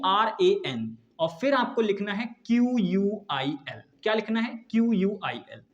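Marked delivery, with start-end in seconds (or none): none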